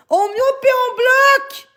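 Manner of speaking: high, slow shouted command; slow furious high shout; shouting order. Real, not acted. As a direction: yell it at the mods, drawn out. Repeats the order, escalating.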